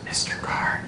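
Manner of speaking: hushed, voiceless stage whisper. Retold or imitated. Flight attendant summoning a passenger by name, whisper. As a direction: whisper it discreetly into someone's ear.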